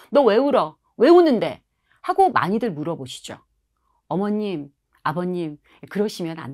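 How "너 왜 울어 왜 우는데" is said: loud, pushy demand to stop crying; impatient parent. Retold or imitated. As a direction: snap at the crying child to hurry it along. Parent rushing to end tantrum. (떼쓰는 아이를 다그치는 부모)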